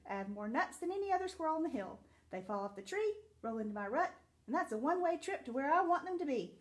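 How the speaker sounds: fast, eager chatter; talking fast. Acted. Boastful squirrel telling the dog about his nuts, fast chatter. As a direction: rattle it off like a busy squirrel. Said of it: "That squirrel could talk really fast".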